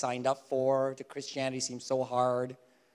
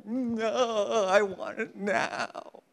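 high, drawn-out bratty whine; mock tantrum; 6 semitones higher, much slower than usual. Believer throwing a spiritual tantrum, hammed-up high whine. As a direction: stretch it out like a tantruming child. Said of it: "I'll throw a spiritual tantrum".

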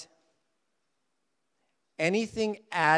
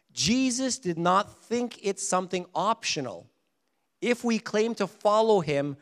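ordinary talk

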